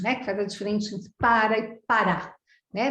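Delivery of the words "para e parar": PARA stressed on the first syllable, PARAR on the last; stress shift contrast. Shown as a demonstration. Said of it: "aquela nossa sílaba forte".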